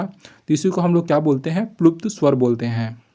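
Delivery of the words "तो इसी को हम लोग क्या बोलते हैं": usual speaking voice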